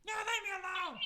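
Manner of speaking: high, loud pleading yell; frantic shout. Acted. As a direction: scream at them to back off. Character fights back.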